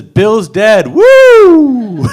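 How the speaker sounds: loud, high mock party whoop; party cheer. Comic cheering a party about a death, whooping. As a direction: whoop it up like a reveler.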